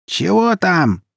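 high, loud call from another room; mother hollering, pitched high; creaky mother calling. A narrator's reading. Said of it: "проскрипела из комнаты мать".

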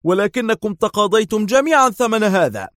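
high, loud angry shout. A narrator's reading. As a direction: shout it in his face. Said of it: "صاح دار في وجهه غاضبا".

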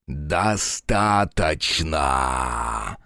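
vast deep dragon boom; thundering dragon. Acted. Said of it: "прогремел дракон".

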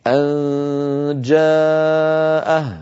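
أن جاءه with the n hidden in a long nasal hum before the j; slow nasal أن جاءه, no closure before the j; ikhfa demo. Shown as a demonstration. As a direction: hum through أن then open into جاءه. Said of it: "أنتقل من مخرج الهمزة إلى مخرج الجيم من غير إغلاق".

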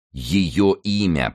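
slow, weighty demand; insistent repetition. A narrator's reading. Monk presses the dying man for a name, slow and insistent. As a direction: draw the words out to force an answer. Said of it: "повторял монах".

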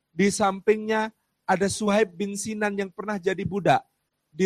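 preaching with rising fervour, loud and high; rousing sermon. Acted. Preacher stirring the congregation with the companions' story. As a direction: build it up like a preacher painting the scene.

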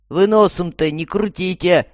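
loud telling-off; gruff order. A narrator's reading. Guard to new prisoners, barked order. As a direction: snap loudly at the new arrivals. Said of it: "прикрикнул на новеньких охранник".

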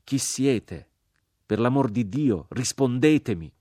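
loud, high, urgent demand; crying out. A narrator's reading. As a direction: plead loudly for an answer.

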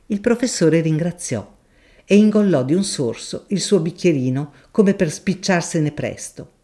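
usual speaking voice

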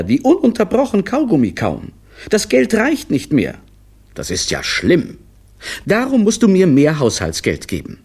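high, quick reproachful voice; reproachful wife. A narrator's reading. Wife reproaches her husband over lunch excuses, high and quick. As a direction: throw his own words back at him.

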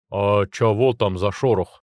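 slow dim guard. Acted. Slow-witted guard asks.

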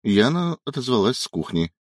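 ordinary delivery

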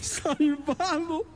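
laughing with relief, last word stretched slow and high; relieved laugh. Acted.

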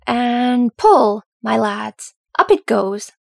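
loud call to the hauling crew; hauling cry. Acted. Sergeant calling out to dwarves hauling the beam, loud. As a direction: call out the pull across the battlements. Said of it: "The cry rang out".

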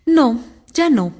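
slow, drawn-out wistful no; resigned and wistful, taken slowly; mildly melancholy storybook voice. A narrator's reading. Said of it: "con aire melancólico".

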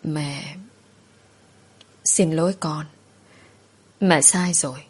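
put-on soft, broken words of a weeping old mother; mild sobbing plea for forgiveness; tearful apology. A narrator's reading. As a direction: whimper out the apology, halting. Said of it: "mếu máo rồi nói".

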